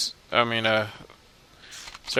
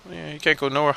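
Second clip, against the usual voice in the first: normal talking voice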